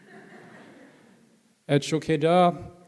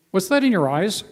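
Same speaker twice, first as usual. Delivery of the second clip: higher, louder quoted question; imitated pointed question; 5 semitones higher, 6 dB louder than usual. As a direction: act out one friend challenging another. Acts out one soldier teasing another.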